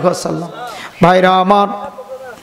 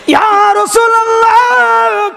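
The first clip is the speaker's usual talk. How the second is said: imitated fervent call; 11 semitones higher than usual. Preacher voicing Abu Bakr calling to the Prophet, high fervent call. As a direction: call out high, holding the endings long.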